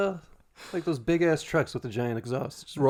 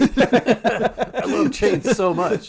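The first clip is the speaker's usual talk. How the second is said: laughing mock gush; 5 semitones higher, 5 dB louder, much slower than usual. Real, not acted. Mocking friend's excitement.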